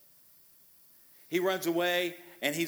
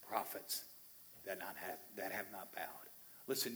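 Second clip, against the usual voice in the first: much softer, hushed and tender; gentle reassurance; 2 semitones lower, 6 dB quieter than usual. Acted. God tenderly reassuring the despairing Elijah, hushed. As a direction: comfort a worn-out friend quietly.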